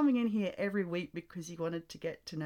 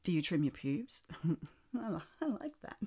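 ordinary talk

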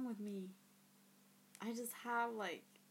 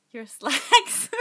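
much louder, higher, breaking into laughter; giggly; 4 semitones higher, 19 dB louder than usual. Real, not acted. Voice actor retrying a silly line, bursting with giggles. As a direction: crack up halfway through the line. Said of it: "all, like, giggly".

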